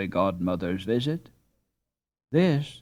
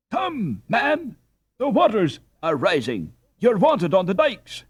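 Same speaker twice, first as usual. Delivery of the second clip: much higher, louder alarmed shout of warning; urgent cry; 10 semitones higher, 6 dB louder than usual. A narrator's reading. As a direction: cry out that the waters are rising.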